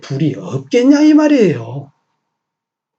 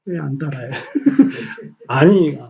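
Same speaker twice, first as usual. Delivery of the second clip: normal talking voice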